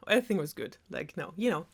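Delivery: usual speaking voice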